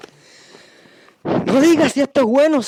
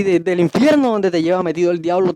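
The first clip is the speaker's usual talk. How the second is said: usual speaking voice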